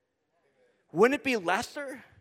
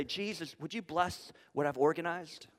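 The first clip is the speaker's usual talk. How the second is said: soft prayer; 4 semitones lower, 9 dB quieter than usual. Retold or imitated. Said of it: "then say".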